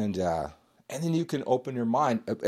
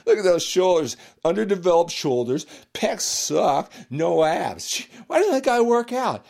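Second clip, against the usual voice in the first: louder, higher scoffing gym-bro voice; mock gym bro; 7 semitones higher, 8 dB louder than usual. Bodybuilder mocking a TV star's body, loud higher sneer. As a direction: sneer at the actor like a cocky lifter. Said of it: "and you go".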